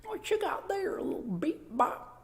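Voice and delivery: put-on high, quiet old granny voice; grandma voice. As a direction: croon it like a doting grandma. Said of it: "Think like a half grandma mouth".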